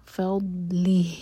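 ordinary talk, nothing marked

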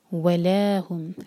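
ला of वला held two beats. Shown as a demonstration. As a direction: stretch the ला in वला evenly. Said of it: "ला दो हरका है ये".

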